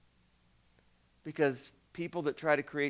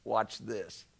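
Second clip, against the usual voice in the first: 1 poker-faced God; 6 semitones lower, 6 dB quieter than usual. Pretend. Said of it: "He's got the straight face on going".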